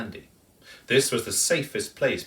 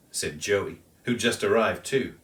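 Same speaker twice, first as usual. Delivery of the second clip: ordinary delivery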